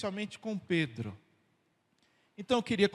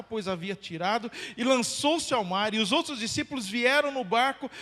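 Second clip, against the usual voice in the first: higher, louder dramatic Gospel reading; scripture reading; 5 semitones higher, 7 dB louder than usual. Acted. Pastor reading Jesus questioning Peter to the church, dramatic. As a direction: let the story swell toward Peter's questioning.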